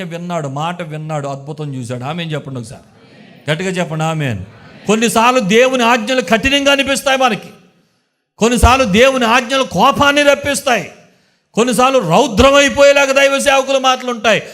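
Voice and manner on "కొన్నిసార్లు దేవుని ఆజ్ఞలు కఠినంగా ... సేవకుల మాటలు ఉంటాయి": loud, high, rousing sermon climax; full-force preaching. Acted. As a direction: preach it out to the whole church. (గట్టిగా, ఉత్సాహంగా ప్రసంగం)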